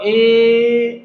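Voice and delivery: ए held long and level. Shown as a demonstration. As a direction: stretch ए to model the sound. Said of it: "जैसा sound करता है".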